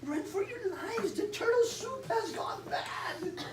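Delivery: very high frantic alarm; a high squeaky panicked shout; panicked cry. Acted. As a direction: warn everyone in a panic. Panicked kitchen creature shouting.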